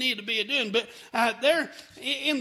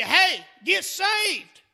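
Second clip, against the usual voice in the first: slow, higher and louder, pleading out a warning; urgent plea from hell; 6 semitones higher, 5 dB louder, much slower than usual. Retold or imitated. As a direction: call it up like a soul begging from the fire. The damned calling out from hell.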